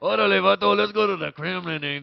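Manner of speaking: put-on absurd Mexican-accented leader, building to a loud boast; Vladimir Putin. Comic playing the Russian leader bragging, heavy Mexican accent. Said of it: "speaks with a heavy Mexican accent".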